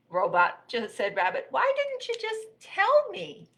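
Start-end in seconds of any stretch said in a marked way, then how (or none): 1.5-3.5 high, slow, drawn-out whine; exasperated complaint. Acted. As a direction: whine it, stretching the words. Rabbit exasperated at robot.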